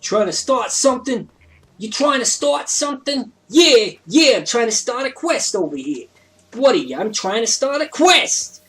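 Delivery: high, loud, hammed-up New York accent; bad New Jersey accent. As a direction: badly fake a pushy New Jersey accent. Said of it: "worst accent that I've done yet".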